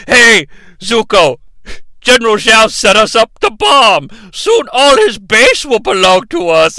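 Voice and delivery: grand mock-sinister declaration; meme villain voice. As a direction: ham up the old game meme line. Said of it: "the uncle's like".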